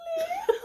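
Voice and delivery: very high piercing lemur cry; lemur call. Pretend. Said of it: "you're so good!".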